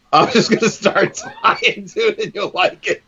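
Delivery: high, laughing through the words. Real, not acted. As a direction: chuckle while teasing. Teasing the cohost while tying.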